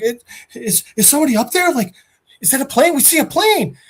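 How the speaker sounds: high, eager imitation of a radio caller; hopeful calling out. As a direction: act out tentative then excited hailing. Radio voices calling up to plane.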